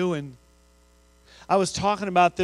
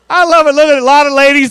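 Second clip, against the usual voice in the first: much higher and louder, thrilled; delighted; 9 semitones higher, 13 dB louder than usual. Real, not acted. Speaker reacting to honest audience, delighted and loud. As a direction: crow with delight at the crowd. Crowd admits shutting closets.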